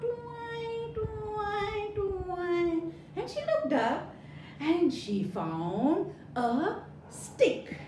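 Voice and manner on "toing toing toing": pluck each toing like a spring; imitated toing noise. A narrator's reading. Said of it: "she heard this sound".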